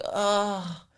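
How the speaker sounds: high, soft mock groan; a hint of put-upon whine; imitated bratty groan. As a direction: sigh it like a spoilt brat. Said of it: "that was just her whole vibe".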